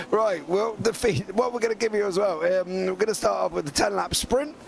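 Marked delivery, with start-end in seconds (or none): none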